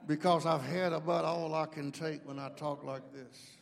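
low, quiet, tightly held-back anger; through the teeth. Shown as a demonstration. Dad scaring his children when fed up, low through the teeth. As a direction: talk through your teeth, keeping it together. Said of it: "talk to us through your teeth".

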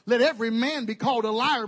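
normal talking voice

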